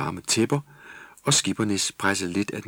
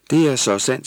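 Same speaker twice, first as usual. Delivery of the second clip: higher, louder with emphasis on "Det"; emphatic agreement; 6 semitones higher, 8 dB louder than usual. A narrator's reading. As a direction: press down hard on "Det". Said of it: "sagde Tulle med eftertryk". (med eftertryk på "Det")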